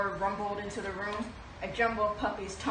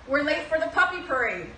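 louder and higher, excited storybook urging; eager hurry; 6 semitones higher, 6 dB louder than usual. Acted. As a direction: hurry them along with cheer. Pongo urges, reading to children.

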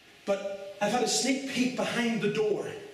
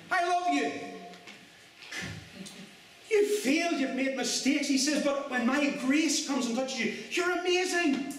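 preaching as Jesus; 5 semitones higher than usual. Acted. Jesus telling the failed listener he loves them, raised and joyful. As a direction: lift the voice as Jesus, delighting in them.

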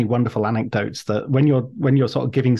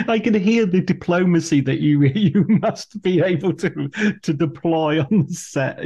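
laughing; 6 semitones higher than usual. Real, not acted. Host amused by guest's diplomacy.

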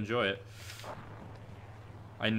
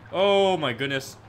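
slow, drawn-out, louder and higher; tense excitement; 5 semitones higher, 8 dB louder, slower than usual. Real, not acted. Streamer watching his trick, drawn-out tense exclamation. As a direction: stretch it out as the trick nearly lands. Nearly landing the trick live.

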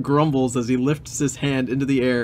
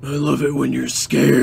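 creepy man voice; 4 dB louder than usual. Acted. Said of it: "a creepy man voice".

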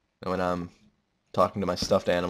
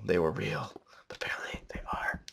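near-whispered, trailing into a whisper; hushed awe; 8 dB quieter than usual. Real, not acted. Player finding a ravine he doubted existed, awed whisper. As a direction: drop to a whisper in wonder.

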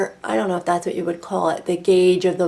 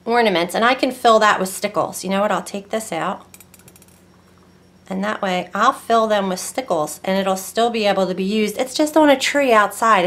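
ordinary talk

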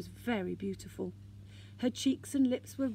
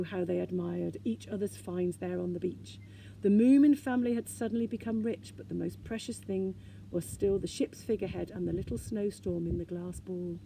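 ordinary delivery, nothing marked

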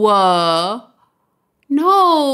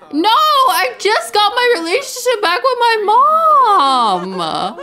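voice breaking; 10 semitones higher, 3 dB louder, slower than usual. Real, not acted. Grieving sim mother dying.

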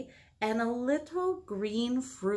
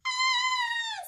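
very high playful shriek; goblins' scream. Acted. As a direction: squeal like frightened little goblins. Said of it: "screamed the goblins".

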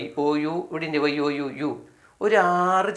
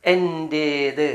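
slow drill; much slower than usual. Shown as a demonstration. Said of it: "എന്താണ്?".

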